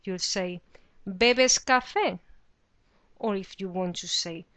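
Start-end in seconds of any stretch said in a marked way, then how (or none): 1.1-2.2 "¿Bebes café?" asked slowly and loudly, lifting on café; slow teacher's question tune on "¿Bebes café?"; question intonation. Shown as a demonstration. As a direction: ask "¿Bebes café?" as a clear question, pitch raised on café. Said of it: "change the intonation of your voice".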